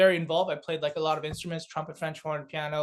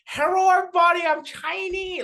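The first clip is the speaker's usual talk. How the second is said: mock Chinese accent, very high; hammed-up, high-pitched caricature of a Chinese accent; Dave Chappelle; 13 semitones higher, 6 dB louder than usual. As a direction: imitate a badly faked Chinese accent, loud then easing. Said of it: "typical Chinese".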